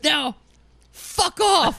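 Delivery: high, loud dismissive shout. Retold or imitated. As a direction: bark the order at the crowd. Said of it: "and he goes".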